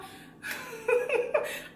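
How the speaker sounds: a bright, high burst of laughter. Real, not acted. Vlogger cracking herself up after the sung title. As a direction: laugh at your own joke.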